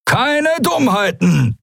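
high, slow, squeaky villain; a menacing creature warning the prisoner, pitched high and slow; Halbhorn's voice. A narrator's reading. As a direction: stretch it out way up high. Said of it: "wiederholte Halbhorn".

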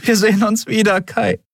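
high, emotional farewell; tearful promise. A narrator's reading. Andriel parts from Kai in tears, tearful promise. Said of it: "versprach sie".

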